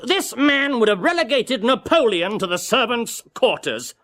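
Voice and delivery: ordinary delivery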